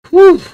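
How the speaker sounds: big loud high whew of release; loud relieved whew; loud exhale. Real, not acted. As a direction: blow it all out at once. Exhale after angry rant.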